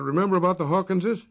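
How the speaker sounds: Alex shouting over the car's roar; shouted over the car. Acted. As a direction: call it over the engine noise. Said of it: "Alex shouted".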